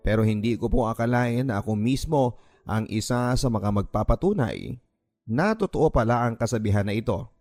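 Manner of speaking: ordinary talk, nothing marked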